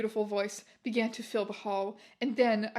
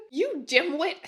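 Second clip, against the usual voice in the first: angry insult; 4 semitones higher, 4 dB louder, slower than usual. Acted. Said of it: "Snake began to shoot angrily".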